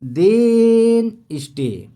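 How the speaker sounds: high, loud pleading "then stay"; a lover begging her partner to stay; voicing the lover. Teacher voices the girlfriend's plea, loud and high.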